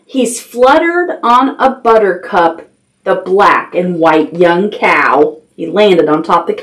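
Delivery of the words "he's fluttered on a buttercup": ordinary delivery, nothing marked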